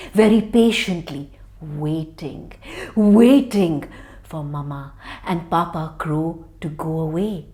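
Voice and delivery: low, soft, secretive telling; quiet spooky storytelling; suspenseful hush. A narrator's reading. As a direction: hush the children for the hidden danger. Snake hidden in knothole.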